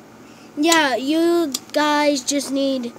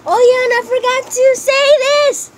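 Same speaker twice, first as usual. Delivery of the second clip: suddenly excited; 8 semitones higher, 4 dB louder than usual. Real, not acted.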